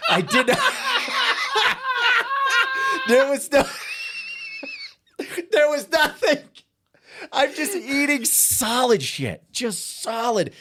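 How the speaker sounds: high, loud squeal through laughter; helpless laughter. Real, not acted. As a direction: crack up while confessing the disaster. Reliving his bombing, cracking up.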